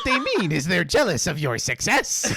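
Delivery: lightly put-on evil Pikachu, laughing; a hint of cartoon villain Pikachu. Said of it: "Let me do the evil Pikachu voice".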